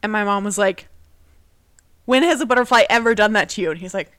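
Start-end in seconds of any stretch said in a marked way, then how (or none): 2.0-3.7 louder, quicker rhetorical question; retold incredulous challenge; mom's emphatic challenge. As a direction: press the point home, loud and fast.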